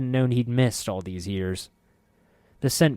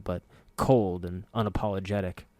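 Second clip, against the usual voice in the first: ordinary delivery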